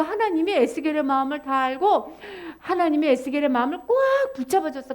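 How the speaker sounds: ordinary delivery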